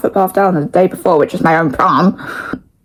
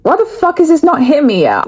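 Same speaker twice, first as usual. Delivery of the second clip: mock frustration; 8 semitones higher than usual. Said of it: "and I was like".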